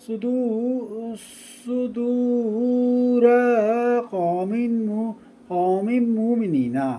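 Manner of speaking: slow melodic chanting of the verses; Quran recitation. Shown as a demonstration. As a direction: intone each phrase with a drawn, sung cadence. Teacher resumes Quranic recitation.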